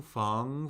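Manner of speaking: "feng" rising steadily; second tone. Shown as a demonstration. Teacher cycling four tones on a syllable, "feng" rising. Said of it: "Let's do the four tones".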